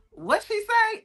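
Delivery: squeaky confused-listener voice; mock confused listener. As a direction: imitate a baffled audience member. Confused audience quoted.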